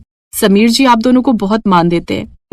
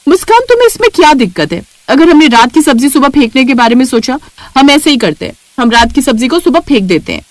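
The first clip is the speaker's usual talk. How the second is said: higher, fast, sharp start; irritated sister-in-law; 3 semitones higher than usual. Acted. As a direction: brush off her objection impatiently. (चिढ़ी हुई जेठानी, तेज़ ऊँची आवाज़)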